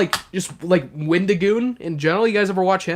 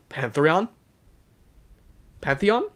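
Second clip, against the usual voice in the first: ordinary delivery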